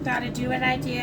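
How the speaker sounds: usual speaking voice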